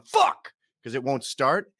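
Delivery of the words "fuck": sharp high curse, much higher; frustrated yell. Retold or imitated. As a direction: blurt it out in frustration. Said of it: "I'm just going".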